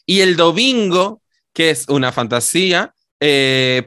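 ordinary talk, nothing marked

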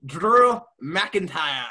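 put-on loud rolled-r Scottish accent, drawn out. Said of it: "I have to do an accent for".

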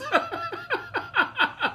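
squealing high laugh. Real, not acted. Big laugh after quote.

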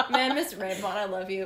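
ordinary delivery

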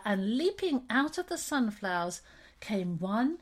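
ordinary delivery

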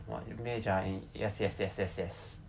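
ordinary talk

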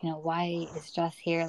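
ordinary talk, nothing marked

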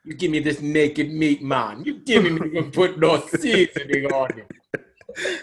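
lightly voiced Jamaican crab; hint of Jamaican patois; Jamaican accent. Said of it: "from Jamaica".

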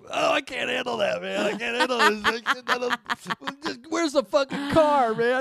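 very high, loud, laughing wail, slowing into sing-song; freaked-out shout. Retold or imitated. Tripping hiker freaks out over the ghost idea, loud and hysterical.

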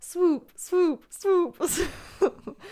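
high, loud crowd chant; cheering crowd. Acted. Podcaster imitating the crowd cheering for Swoop, cheering chant. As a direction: chant it like fans, breaking into a laugh at the end. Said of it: "you hear the crowd cheering".

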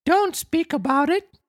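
high-pitched puppet voice wailing; frightened wail. Acted. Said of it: "wailed Pinocchio, still trembling with fright".

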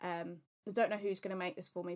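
ordinary delivery

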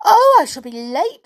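put-on high, loud little-girl fluster; worried storybook girl, high and loud; alarmed cry. A narrator's reading. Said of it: "she cried".

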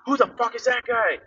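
high, loud angry outburst; sudden snap. Retold or imitated. Guy suspicious of a stranger standing there, snapping. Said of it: "like, snaps".

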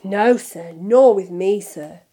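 bowing and scraping; fawning Borgin. A narrator's reading. Borgin bowing to Malfoy, fawning. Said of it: "with a deep bow".

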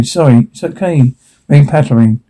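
usual speaking voice

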